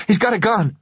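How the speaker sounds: shrill desperate second cry; panicked shriek. Acted. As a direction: voice cracks up high in terror. Repeated panic, higher.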